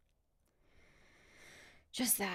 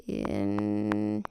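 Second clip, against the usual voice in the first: subharmonic voice; 11 semitones lower, 6 dB louder than usual. Shown as a demonstration. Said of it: "my subharmonic voice".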